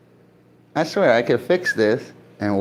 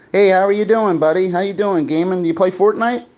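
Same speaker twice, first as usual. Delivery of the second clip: loud, bright, high greeting; cheery greeting; 8 semitones higher, 4 dB louder than usual. Acted. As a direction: greet them like a kids' show host. Greeting viewers in upbeat voice.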